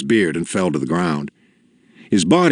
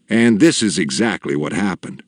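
ordinary delivery, nothing marked

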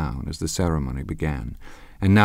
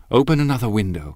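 quick, higher order tossed over the shoulder; commanding; 6 semitones higher, 4 dB louder, faster than usual. A narrator's reading. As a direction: give the order without turning round. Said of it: "commanded Daisy".

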